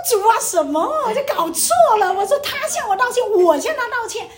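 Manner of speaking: loud, high, incredulous outburst; retold outraged objection, pitched high; indignant protest. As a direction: protest to God as if utterly wronged.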